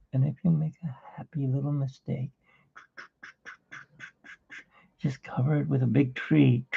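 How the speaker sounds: hushed, calm TV painter; soft Bob Ross. Retold or imitated. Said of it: "he's more like this".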